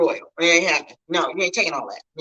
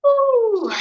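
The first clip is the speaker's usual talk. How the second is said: ooh; 6 dB louder than usual. Real, not acted. Reacting to sad story.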